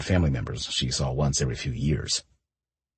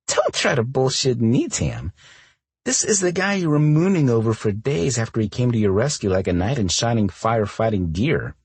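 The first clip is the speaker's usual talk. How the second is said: put-on higher, bright teasing girlfriend; teasing friend voice; 7 semitones higher, 4 dB louder than usual. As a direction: needle her playfully, pitched up. Cora teasing her friend.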